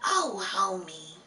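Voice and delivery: a slow, lightly raspy Marge impression; Marge Simpson voice. Streamer teasing chat in a slow, raspy Marge voice. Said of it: "that's my Marge voice".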